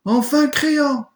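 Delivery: very high, loud, slow relieved cry. Acted. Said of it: "dans un cri soulagé".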